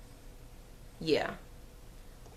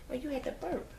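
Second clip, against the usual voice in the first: high, soft coo; 9 semitones higher, 9 dB quieter, faster than usual. Real, not acted. Mom talking to baby.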